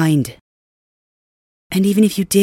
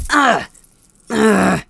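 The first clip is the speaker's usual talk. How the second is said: sharp, higher grunts of pain; 6 semitones higher, 6 dB louder than usual. Acted. Prisoner being whipped, pained grunts. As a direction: cry out under the lash.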